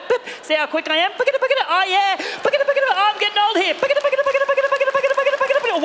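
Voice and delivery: high, frantic checkout chant; impatient self-scanner. Acted. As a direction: rush the items through, chanting. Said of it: "we're like".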